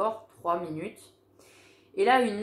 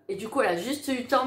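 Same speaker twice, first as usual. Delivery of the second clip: normal talking voice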